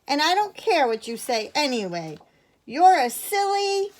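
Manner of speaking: high, loud backtalk, rising to a taunt; defiant child. A narrator's reading. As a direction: sass the grown-up. Edith talks back, calls him silly.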